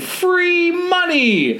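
very high, very slow drawn-out call; Denny echoes the shout. A narrator's reading. Little brother echoing the call, very high drawn shout. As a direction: stretch it out like a kid copying. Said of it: "Denny shouts the same thing".

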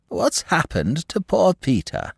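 high, slow society lady voice; imperious plummy grande dame. Acted. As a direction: play a grand old duchess. Duchess greets Parker at inquest.

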